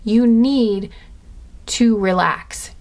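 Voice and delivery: urgent warning, deliberate; imperative. Real, not acted. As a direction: press need hard, then slow down. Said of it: "they're saying it's imperative".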